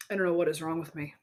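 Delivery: ordinary talk, nothing marked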